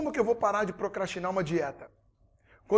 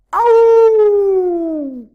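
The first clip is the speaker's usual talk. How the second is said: dog bark; 11 semitones higher, 14 dB louder than usual. Retold or imitated. Dog barking in story.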